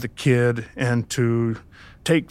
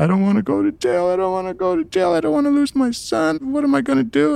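pleading, voice rising high; imitating a panicked, tearful plea; a hint of hysterical crying; retold hysterical crying; 12 semitones higher than usual. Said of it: "she starts crying hysterically".

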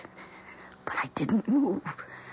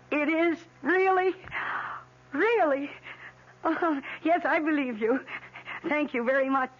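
high, breathless joy; can't believe the good news; overjoyed; 10 semitones higher, slower than usual. Real, not acted. Learns she's pregnant on phone.

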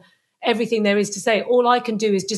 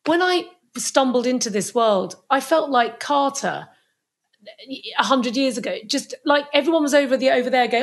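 usual speaking voice

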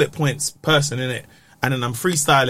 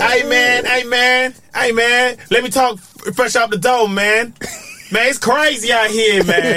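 higher, hyped-up stage voice; American comic voice; 7 semitones higher, slower than usual. Pretend. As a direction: lightly do a hype US comic.

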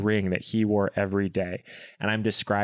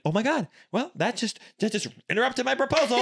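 high, fast mock exasperation; exasperated disbelief. Retelling the ruined proposal moment, high and exasperated. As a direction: throw up your hands in disbelief. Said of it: "I was like".

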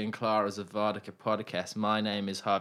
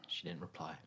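whispered; 5 semitones lower, 12 dB quieter than usual. Real, not acted. Whispered aside.

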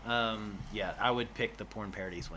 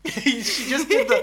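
she just did the laughed out much higher and louder, slowly; laughing; 14 semitones higher, 10 dB louder, slower than usual. Real, not acted. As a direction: giggle while pointing it out. Laughing at partner's excited gesture.

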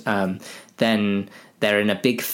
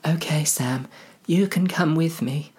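higher hobbit voice; relenting Frodo; 7 semitones higher than usual. Acted. Frodo lets Sam come along, higher voice. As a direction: give in gently, pitched up.

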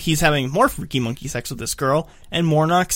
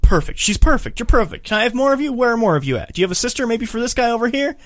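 mock gushing; 8 semitones higher, 3 dB louder than usual. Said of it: "And Ken's like".

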